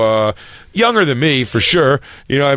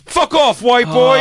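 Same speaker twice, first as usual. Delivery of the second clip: angry heckler; 10 semitones higher, slower than usual. Retold or imitated. Heckler voiced at comic.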